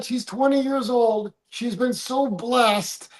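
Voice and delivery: normal talking voice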